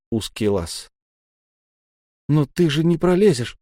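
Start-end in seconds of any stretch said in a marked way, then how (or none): none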